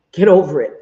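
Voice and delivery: loud, punched GET over it; emphatic dismissal. Real, not acted. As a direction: hit it hard, telling critics off. Repeats it forcefully to critics.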